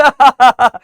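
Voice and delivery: loud show laugh; demonstrative laugh. Shown as a demonstration. (दिखावटी ज़ोरदार हँसी)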